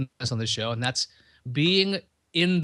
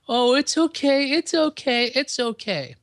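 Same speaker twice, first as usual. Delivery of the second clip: put-on much higher, louder cooing baby talk, fading by the end; coddling; 11 semitones higher, 4 dB louder, slower than usual. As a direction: coo at a scared pet like it's a baby. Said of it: "start going".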